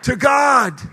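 slow, loud Pittsburgh yins, lightly put on; preacher leading crowd in Pittsburgh accent. Shown as a demonstration. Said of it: "Say it like you're from Pittsburgh".

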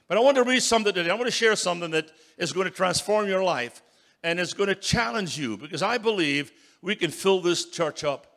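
building revival preaching; full-force preaching. Acted. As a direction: rouse the congregation.

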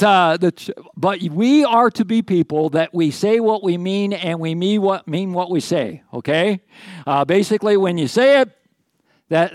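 loud, high, emphatic pulpit voice; preaching at full force. Acted. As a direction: drive the point home to the congregation.

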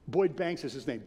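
ordinary delivery

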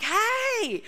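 sharp high shout; yelling hey. Retold or imitated. Woman shouting at the driver who hit her leg. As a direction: yell at the driver who just hit you.

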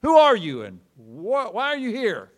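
loud, high angry bark; barking soldier. Acted. Soldier challenging the wandering rabbi, loud angry bark. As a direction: bark it like a guard catching an intruder. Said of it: "the bark of a Russian soldier".

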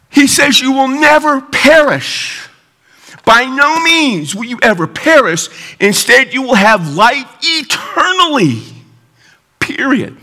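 high, slow pulpit declaration; emphatic preaching. Real, not acted. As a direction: proclaim it with long, weighted key words.

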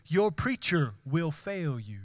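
usual speaking voice

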